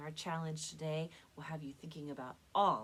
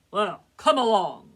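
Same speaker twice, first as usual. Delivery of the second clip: booming goat challenge; big loud goat; 3 semitones higher, 18 dB louder than usual. Acted. Biggest goat dares the troll to come, booming challenge. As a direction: dare him at full volume. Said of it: "as loud as the troll's".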